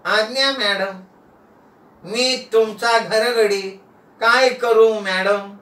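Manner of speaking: slow, drawn-out obedient servant; hypnotised houseboy taking orders; entranced servant. Acted. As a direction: answer slowly, as if under a spell. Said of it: "चॉकलेटच्या प्रभावानं नाना बोलायला लागले".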